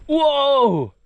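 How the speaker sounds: high, loud, falling whoa; startled whoa. Real, not acted. Trying to pick up the crab, startled cry. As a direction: yelp it in real alarm.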